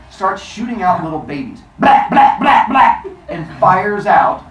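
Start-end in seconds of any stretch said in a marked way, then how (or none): 1.8-3.0 four loud explosive "blah" bursts; machine-gun retching. Retold or imitated. Imitating babies shot out like a machine gun, loud bursts. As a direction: spit out each blah hard and evenly.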